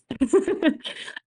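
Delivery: quiet rising chuckle; soft laugh. Real, not acted. Guest hoping for a second series, soft laugh. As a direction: laugh a little at yourself.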